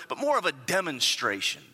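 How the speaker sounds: normal talking voice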